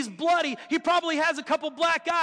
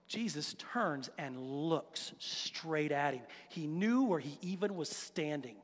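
much lower and softer, slowing down; hushed awe; 10 semitones lower, 11 dB quieter, slower than usual. Real, not acted. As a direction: drop to a grave hush for the climax. Dramatic hush at Jesus' look.

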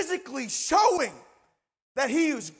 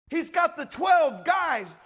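ordinary delivery

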